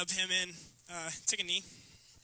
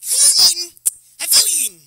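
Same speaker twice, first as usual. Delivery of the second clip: loud, high mock-mother yell; high-pitched hammed-up nagging; nagging mom impression; 10 semitones higher, 16 dB louder than usual. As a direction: holler it like an exaggerated fussing mom. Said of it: "She's like".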